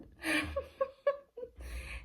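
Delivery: high, breathy burst of laughter; laughing. Real, not acted. Laughing at her suddenly gentle dad, amused laughter.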